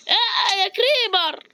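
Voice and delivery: high sudden yelp at the creeper; startled cry. Real, not acted. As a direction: jump out of your skin as the creeper appears.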